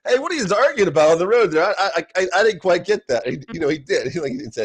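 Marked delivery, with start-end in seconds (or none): 0.0-3.2 teasing bro Jesus. Retold or imitated. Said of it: "this is the people he hangs out with".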